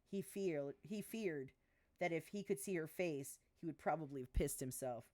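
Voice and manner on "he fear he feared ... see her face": plain reading voice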